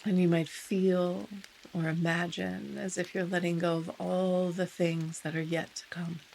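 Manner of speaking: unhurried meditation guide with long pauses; guided meditation. Real, not acted. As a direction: ease listeners into stillness, softening as phrases end.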